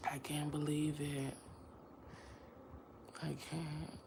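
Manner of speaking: soft unvoiced whisper, trailing off; whispered disbelief. Real, not acted. Stunned by her own loss, a soft whisper. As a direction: let it fade to a breath.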